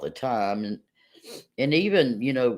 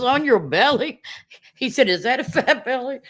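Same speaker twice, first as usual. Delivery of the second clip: imitating her little boy; 9 semitones higher than usual. Son's innocent question retold.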